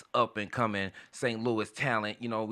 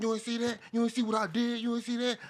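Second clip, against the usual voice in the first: imitating excited guy; 10 semitones higher, 4 dB quieter than usual. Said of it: "So he was like".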